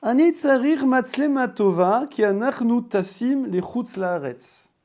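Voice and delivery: loud, high-pitched customer voice. Shown as a demonstration. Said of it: "et la voix aiguë".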